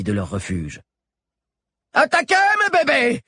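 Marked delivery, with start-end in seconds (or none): 1.9-3.2 high-pitched, loud battle cry; shouted order. A narrator's reading. Chado urges his caracals to attack, high loud shout. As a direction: shout it high to urge them on. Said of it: "répéta Chado".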